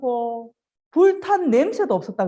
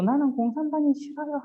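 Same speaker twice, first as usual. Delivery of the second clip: lightly voiced boy, higher and softer; brave little boy; 5 semitones higher, 5 dB quieter than usual. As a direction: quote it high, like a child holding firm. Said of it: "죽는 줄 알면서도". (살짝 높고 작게 흉내 낸 소년의 목소리)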